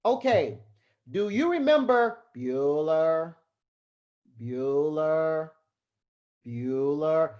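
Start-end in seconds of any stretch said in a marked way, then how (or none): 2.4-7.3 flat droning roll call, a bit lower; lightly done Ben Stein roll call; Ben Stein monotone. Retold or imitated. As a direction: drone Bueller like a bored teacher. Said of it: "sounds like Ben Stein".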